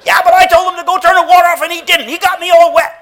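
put-on very high, loud whining kid; whiny child. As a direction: complain like a wet little boy. Child complaining, voice acted.

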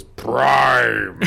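booming loud robot villain call; Megatron voice. Retold or imitated. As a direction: bellow the name like Megatron. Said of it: "when Megatron goes".

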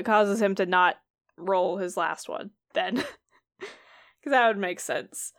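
ordinary delivery

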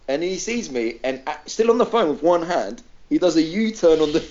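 usual speaking voice

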